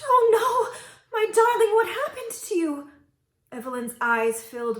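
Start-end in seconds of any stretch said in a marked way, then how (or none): 0.0-2.9 high, loud horrified gasp; gasping shock. A narrator's reading. Queen seeing her daughter's changed face. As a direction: cry out in shock. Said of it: "The queen gasped".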